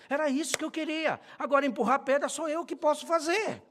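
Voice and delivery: loud, high fiery sermon climax; booming pulpit preaching; preaching at full force. Acted. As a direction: rouse the congregation at full voice.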